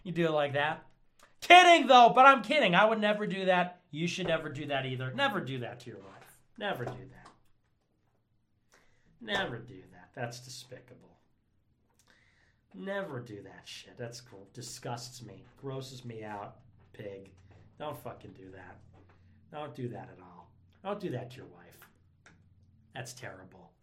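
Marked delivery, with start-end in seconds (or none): 1.4-3.8 high, loud joking retraction; emphatic disclaimer. Pretend. Loudly retracting the joke. 6.6-23.7 low, soft scolding; hushed show of disgust with long pauses; mock-solemn disapproval. Mock-solemn disapproval of own joke.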